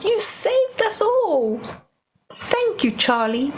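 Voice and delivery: king thanking in a lifted voice; grateful king. Acted. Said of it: "And the king says".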